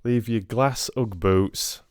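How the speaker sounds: broad Yorkshire storyteller spinning a cheeky Cinderella; Yorkshire accent. Pretend. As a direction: tell it like a Yorkshire lad down the working men's club. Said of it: "read it in Yorkshire".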